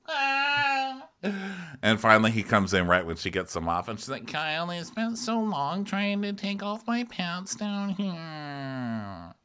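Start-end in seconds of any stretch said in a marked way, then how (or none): 0.0-1.1 very high, long wailing name; hammed-up high whine, dragging the name out; mock whining call. Amanda stuck in her pants calls Kyle, mock wail. 4.3-9.3 high, quiet, plaintive imitation; mock whine. Imitating Amanda complaining to Kyle about pants, high whine.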